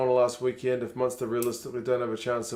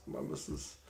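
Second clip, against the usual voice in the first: breathy whisper, much softer, so the wife won't hear; whispering behind his wife's back; whispered aside; 8 semitones lower, 13 dB quieter than usual. Real, not acted. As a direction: drop to a secret whisper.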